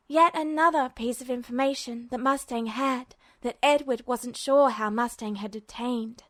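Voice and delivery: ordinary delivery, nothing marked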